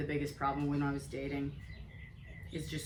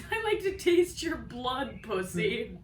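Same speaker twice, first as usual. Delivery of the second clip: high, slow mock creepy line; a friend parodies the line she denies saying, high and slow; mocking her own words; 6 semitones higher, slower than usual. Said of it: "I never said".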